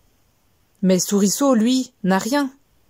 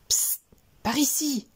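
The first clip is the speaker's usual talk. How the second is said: soft, secretive psst to a friend; hushed call; 5 semitones higher, 7 dB quieter than usual. Acted. Little mouse secretly calling Félix over, hushed. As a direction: call him over under your breath.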